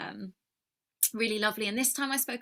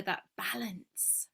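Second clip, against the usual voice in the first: whisper; 3 semitones lower, 6 dB quieter, slower than usual. Real, not acted. Soft reflective emphasis.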